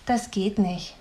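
plain reading voice

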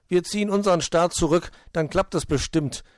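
high, urgent offer; desperate. A narrator's reading. Willi bargains to avoid another ride, desperate. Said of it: "bot Willi verzweifelt an".

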